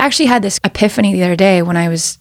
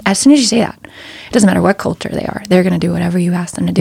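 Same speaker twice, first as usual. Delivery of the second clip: ordinary talk, nothing marked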